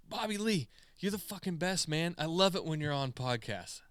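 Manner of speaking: high, gushing fan praise; excited fan shout-out. Retold or imitated. Fan begging a streamer for attention, high and gushing. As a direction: pile on the compliments like a starstruck fan.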